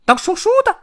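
very high, loud squeaky shout; 野兔朗朗大喊. A narrator's reading. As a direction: yell it out as an excitable hare. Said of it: "野兔朗朗大声喊道". (尖声大喊的野兔)

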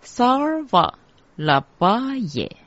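high, measured mantra chant; mantra recitation. Shown as a demonstration. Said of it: "第一个字萨念成萨尔".

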